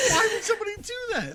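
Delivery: high, fast, crying with laughter; incredulous laugh-cry; laughing in disbelief. Real, not acted. Laughing fit.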